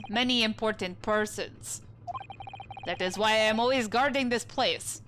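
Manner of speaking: put-on proud, broken English with a Russian accent; Russian sailor accent. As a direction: announce your name like a stern Russian crewman. Sailor describes cabin area.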